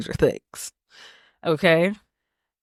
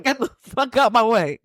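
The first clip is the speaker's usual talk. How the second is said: raised-pitch mock impatience; playful, higher-pitched pushy command; bossy dog voice; 5 semitones higher than usual. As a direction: order them aside, half laughing. Voices dogs pushing past man.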